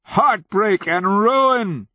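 very slow, high-pitched bellow; roaring, stretched-out outburst; furious roar. A narrator's reading. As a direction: draw out each word in a loud rage. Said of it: "he roared".

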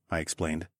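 plain reading voice

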